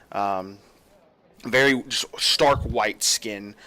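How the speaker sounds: ordinary talk, nothing marked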